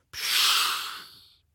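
soft voiceless hiss of blown air; blowing sound. A narrator's reading. Narrator imitates Nisse blowing into the stove, hissing puff. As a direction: blow out a long, soft "psch".

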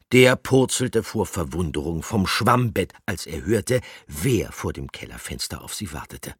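plain reading voice

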